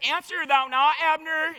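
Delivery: David shouting across to wake Abner; crying out loud. Acted. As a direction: shout it to the sleeping camp. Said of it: "He cries out loud to the people".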